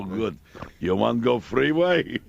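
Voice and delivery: put-on slow, lightly accented broken English; a hint of a Russian cabbie offering the freeway. Jack imitating his Russian cabbie, slow light accent.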